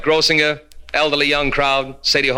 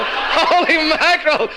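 slow, stretched, higher holy mackerel; drawn-out holy mackerel; 10 semitones higher, slower than usual. Acted. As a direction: drag out Holy mackerel in mock amazement. Repeats it, stretched out.

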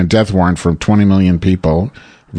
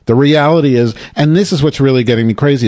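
normal talking voice

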